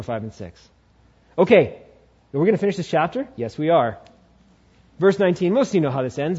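ordinary talk, nothing marked

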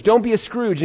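high, eager mock recognition; excited recognition. American who gets the idiom at once, acted excited recognition. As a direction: rattle off the familiar saying in a high voice.

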